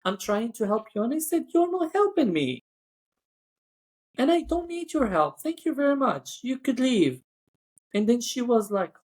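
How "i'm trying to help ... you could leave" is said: high-pitched indignant retort, retold. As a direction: tell off a colleague in a raised pitch. Retold quarrel with bossy colleague.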